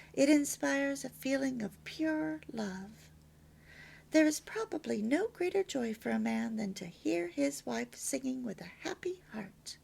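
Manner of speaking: usual speaking voice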